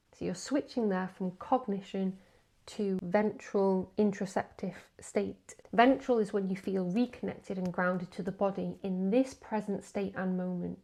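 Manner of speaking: ordinary talk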